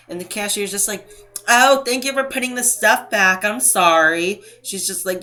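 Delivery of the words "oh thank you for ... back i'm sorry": high, put-on sugary thanks; condescending. Cashier thanking a customer for putting stuff back, mock condescending. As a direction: imitate fake gratitude, talking down. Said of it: "in a really condescending tone".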